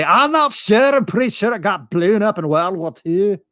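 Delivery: deadpan joke in a fake Scottish accent; Scottish brogue. Fake Scot explains Japan City, mock Scottish brogue. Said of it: "talking, like, Scottish".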